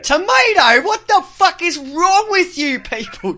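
loud, high-pitched exasperation; mock outrage. Real, not acted. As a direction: indignantly cry out at their pronunciation. Mock outrage at American pronunciation.

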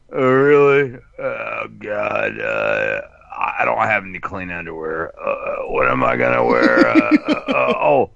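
a hint of a spaced-out junkie, unbothered; mock dazed addict. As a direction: play a numb user who shrugs off disaster. Acts out an indifferent addict.

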